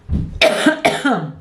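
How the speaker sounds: single dry tickly cough. Real, not acted. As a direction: cough from a scratchy throat. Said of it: "I have a tickle in my throat".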